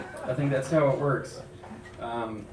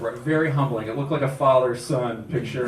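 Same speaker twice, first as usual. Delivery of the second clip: ordinary talk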